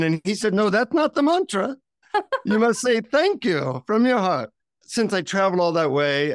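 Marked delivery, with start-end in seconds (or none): 0.5-4.5 high, lilting imitated Indian guru; insistent Indian-accented correction, pitched up. Guru correcting a sarcastic visitor, high Indian accent. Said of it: "in his deep Indian accent".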